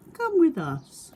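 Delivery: small high eager animal voice; Little Beaver voice. Acted. Little Beaver asking new animals to be friends, high eager voice. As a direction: play a hopeful little beaver, bright and high.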